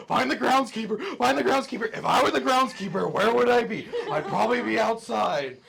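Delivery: high, very loud amplified thoughts; booming voice. Acted. Game master booms the creature's thoughts at Afa, high full shout. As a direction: boom it out like a panicked loudspeaker. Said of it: "you hear this voice that's booming".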